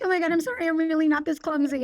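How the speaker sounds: flustered apology. Retold or imitated. Clumsy nerd apologising at dinner, retold fluster.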